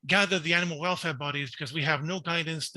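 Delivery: ordinary delivery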